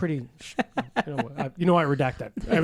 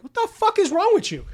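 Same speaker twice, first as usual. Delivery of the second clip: much higher, outraged; mock outrage; 5 dB louder than usual. Outraged at the doctor's doubt, mock outrage.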